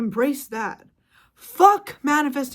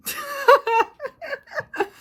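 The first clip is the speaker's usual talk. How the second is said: high laugh; 14 semitones higher, 4 dB louder than usual. Real, not acted. Laughs after rant.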